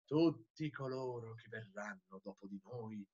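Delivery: very low, soft, solemn voice; hushed declamation. A narrator's reading. Madman foretelling those who come after, solemn hushed voice. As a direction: drop right down for a grave prophecy.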